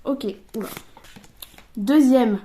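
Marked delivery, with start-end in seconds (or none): 1.8-2.4 loud, drawn-out card reveal; card announcement. Real, not acted.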